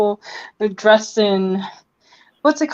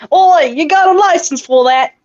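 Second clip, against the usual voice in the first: higher, put-on British challenge; hammed-up British "Oi"; British accent; 6 semitones higher, 4 dB louder than usual. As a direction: call it out in a mock British accent. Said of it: "since you wanna do British".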